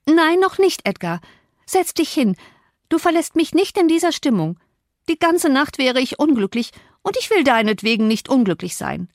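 high-pitched insistent demand; agitated high voice of a demanding heroine; imperious pleading. A narrator's reading. Catherine insists that Edgar stay.

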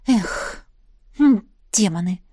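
mildly grumbled curse; displeased. A narrator's reading. Girl missing lunch, displeased. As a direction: sigh and mutter a mild oath. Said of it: "недовольно сказала девушка".

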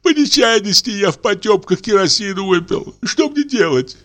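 high whimpering voice of a man who thinks he is dying; frightened old clerk. A narrator's reading. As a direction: tremble through it, half crying. Confessing man.

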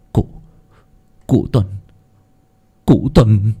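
halting, broken stammer. Acted. Wounded Thầy Nghiêm addressing Lão Tuân, stammering. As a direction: stumble over the name, with pauses between tries. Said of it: "Thầy Nghiêm lắp bắp".

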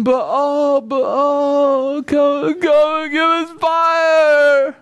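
frantic calling. Acted. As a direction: call out desperately, drawing out the words. Prophets of Baal calling on Baal.

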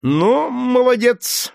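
high, slow, drawn-out sneer; sarcastic "well done"; mocking praise. A narrator's reading. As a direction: slaveholder taunting the beaten man. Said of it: "заговорил Легри".

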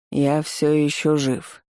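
slow, muffled, toneless robot voice; flat monotone machine speech, dragged out. A narrator's reading. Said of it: "Голос робота звучал глухо и не имел интонации".

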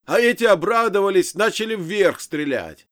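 high, very loud complaint; shouting, half-deaf. A narrator's reading. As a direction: yell it, deafened by ringing ears. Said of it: "прокричал я из-за того".

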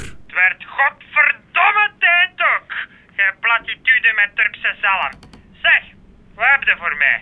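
put-on a grumpy old editor growling; gruff editor. Editor-in-chief grumbling at reporter Klaas, low and slow.

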